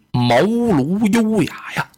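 usual speaking voice

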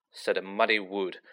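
plain reading voice

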